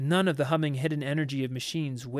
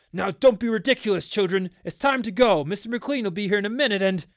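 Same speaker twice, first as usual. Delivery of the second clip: raised, higher voice, speeding up at the end; urgent scolding; 5 semitones higher, 5 dB louder, faster than usual. A narrator's reading. Father ordering children to let them out, urgent. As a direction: scold loudly through a door, hurrying.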